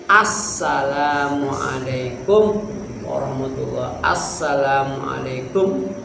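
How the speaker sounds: slow recited salam. Shown as a demonstration.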